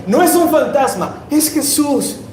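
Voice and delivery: high, excited exclamation; acted joyful realisation, pitched high; relieved recognition. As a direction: exclaim it as the fear turns to relief.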